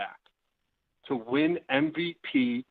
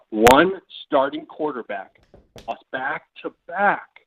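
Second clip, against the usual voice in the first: slower, deliberate reread; slow repeat; 3 dB louder, slower than usual. Shown as a demonstration. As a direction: repeat the question slowly, word by word. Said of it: "repeats question slower".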